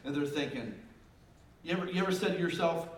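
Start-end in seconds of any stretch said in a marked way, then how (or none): none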